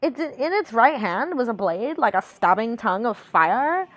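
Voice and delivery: singsong dumb blonde voice, pitch swooping. Pretend. Reader doing redeemed voice for stream, dumb blonde. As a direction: read it like a ditzy blonde. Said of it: "supposed to be reading a Dumb Blonde voice".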